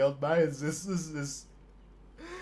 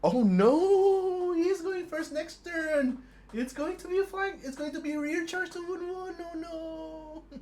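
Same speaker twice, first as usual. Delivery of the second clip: much higher mock wail, half laughing; mock despair; 11 semitones higher, slower than usual. Real, not acted. Streamer watching his unit get flanked, mock wailing. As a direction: wail in playful horror at the flank.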